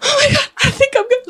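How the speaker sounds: high, fast, tearful rush; fighting back tears; about to cry. Real, not acted. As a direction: voice breaking into tears. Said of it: "I'm already crying".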